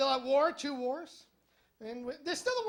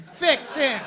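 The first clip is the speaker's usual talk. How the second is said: much louder, blunt order; loud demand; 2 semitones higher, 12 dB louder than usual. Retold or imitated. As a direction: slam the order down on the vet. Demanding the vet cure dog.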